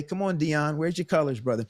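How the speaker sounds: ordinary talk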